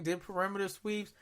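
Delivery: usual speaking voice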